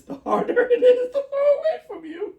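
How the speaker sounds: high laughing voice, words break up; can barely get it out for giggling; reading a silly line through real laughter. Real, not acted. Cracking up reading a quote.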